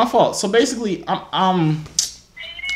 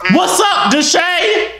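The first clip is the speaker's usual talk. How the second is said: loud; 11 semitones higher, much slower than usual. Real, not acted. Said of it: "Why you screaming?".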